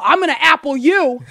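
loud mock-menacing "apple you"; menacing threat. Shown as a demonstration. Dad showing kids how to turn words into threats, mock menacing. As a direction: growl it like a joke threat. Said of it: "it sounds like a threat".

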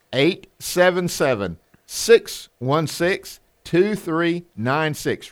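slow and deliberate, digit by digit; slow phone number. Real, not acted. Radio host asking listeners to write the number down, slow. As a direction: say it so they can write it down. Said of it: "Write that down, put it in your phone".

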